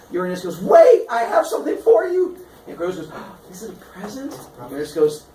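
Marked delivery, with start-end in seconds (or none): 0.6-2.4 high, loud cry to halt; hammed-up desperate shout; urgent plea. Father halts attacking son. 3.1-4.4 put-on hopeful child. Son hopeful about gift.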